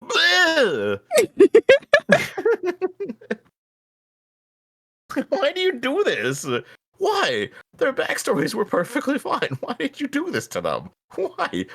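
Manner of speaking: high, outraged whining rant; exasperated rant. Real, not acted. Fan ranting to friend about ruined characters, high outraged whine.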